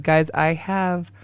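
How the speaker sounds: ordinary talk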